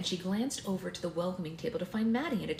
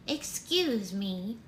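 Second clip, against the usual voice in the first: put-on slow, higher little old lady; grandma voice; 4 semitones higher, much slower than usual. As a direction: politely interrupt as an elderly grandmother. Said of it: "this is just the regular grandma voice".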